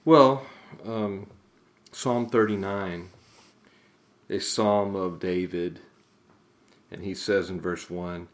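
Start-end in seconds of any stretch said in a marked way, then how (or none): none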